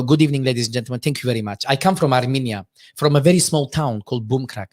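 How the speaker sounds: Armenian-accented comedian's stage patter. Shown as a demonstration. Comic introduces his small Armenian town, Armenian accent. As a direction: greet the room in a thick Armenian accent. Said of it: "I talk about Armenia a lot".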